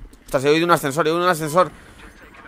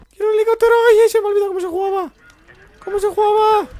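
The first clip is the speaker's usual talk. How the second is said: put-on very high shrieking childish whine; squeaky whine; 7 dB louder than usual. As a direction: squeal like a whiny kid. Whiny tantrum over helicopter.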